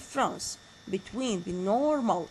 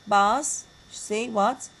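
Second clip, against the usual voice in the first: normal talking voice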